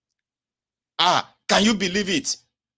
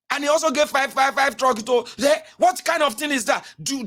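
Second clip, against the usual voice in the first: mock outraged rant; 8 semitones higher than usual.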